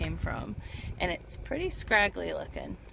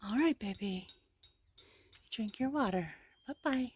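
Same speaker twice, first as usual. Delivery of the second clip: cooing to a scared pet; 3 semitones higher, 5 dB quieter than usual. Real, not acted. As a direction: gently say goodbye to a pet. Farewell to the sheep.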